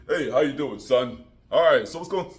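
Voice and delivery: loud hearty boss voice; boss greeting. Acted. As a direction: greet him like a booming boss. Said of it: "Bob comes in".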